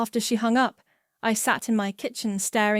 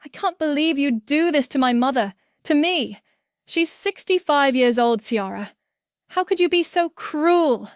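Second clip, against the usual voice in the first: louder, higher, wounded anger; angrier; 5 semitones higher, 5 dB louder than usual. Acted. Chris accusing Ciara of cruelty to his mother, angry and high. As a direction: accuse her with rising hurt. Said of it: "angrier this time".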